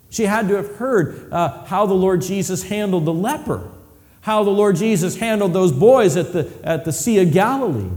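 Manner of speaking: high, loud, building preacher's climax; preaching at full force. Acted. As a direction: swell it up like a revival peak.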